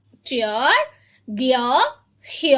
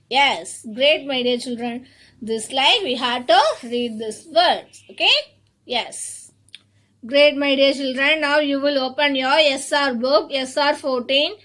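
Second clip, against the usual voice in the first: ordinary talk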